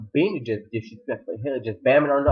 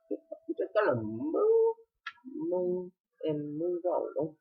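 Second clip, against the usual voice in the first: slow, soft, higher-pitched words; drawn-out aside; 5 semitones higher, 7 dB quieter, much slower than usual. Real, not acted. Recapper brags about paying attention, slow and soft. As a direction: stretch each "move" out softly. Playful aside after boasting.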